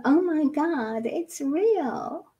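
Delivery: high, breathy mock gasp of realisation; a hint of sarcastic surprise; mock astonishment. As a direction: lightly send up people who only just noticed. Mocking people's belated realisation.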